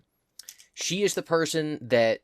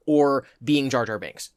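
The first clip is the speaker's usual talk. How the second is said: usual speaking voice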